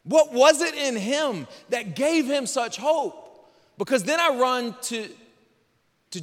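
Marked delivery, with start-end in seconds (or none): 0.0-3.5 amazed wondering. Retold or imitated. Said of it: "I'm like".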